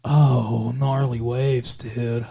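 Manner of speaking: low, slow California surfer drawl; surfer dude accent. Retold or imitated. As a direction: stretch it out like a stoked surfer dude. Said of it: "Surfer dude accent".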